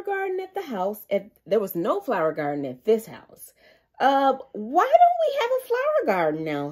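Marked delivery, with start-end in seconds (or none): 4.0-6.7 higher, puzzled question; wondering Peppa. A narrator's reading. Peppa asking Mummy why there is no garden now. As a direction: ask it wondering, a bit louder. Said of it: "said Peppa".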